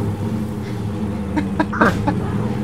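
breathy high-pitched laughter. Real, not acted. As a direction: burst out laughing. Laughing after car crash.

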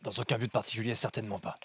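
low quiet lord's reply; stern father, quiet. Acted. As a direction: answer softly, with authority. GM voices father, low and soft.

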